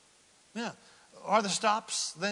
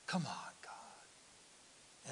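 very slow, much softer whispered plea; fainter whisper; 3 semitones lower, 13 dB quieter, much slower than usual. Retold or imitated. Praying wearily to God in a very soft slow whisper. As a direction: let it fade into a sigh of a prayer. Pleading under breath at delay.

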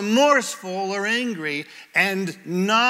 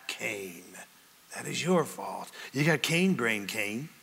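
much lower and softer, pinning the blame on him; low scolding aside; 8 semitones lower, 7 dB quieter than usual. Acted. Preacher addresses Cain directly, blaming him in a quiet, low scold. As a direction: drop the voice to tell Cain it's his own fault.

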